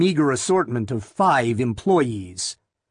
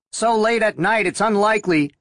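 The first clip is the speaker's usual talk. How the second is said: radio dispatcher; 8 semitones higher than usual. A narrator's reading.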